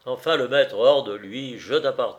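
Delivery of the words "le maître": usual speaking voice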